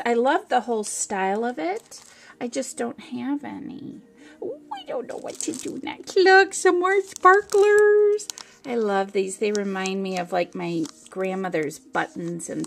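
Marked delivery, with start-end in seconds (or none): 4.4-6.2 fast high whisper; giddy whisper. Real, not acted. Deciding what to open next, a fast giddy whisper. 6.2-8.3 high, loud, slow squeal; delighted squeal. Real, not acted. Showing viewers more sparklers, a loud delighted squeal.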